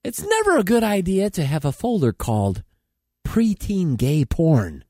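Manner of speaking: comedy newscast with punchy phrasing; mock news read. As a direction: read it like a satirical anchor. Reads mock news items.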